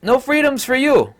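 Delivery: high, loud, put-on sneering decree; mocking tyrant voice. Mocking the president again in a put-on voice, sneering. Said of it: "president of New Zealand saying that flat out".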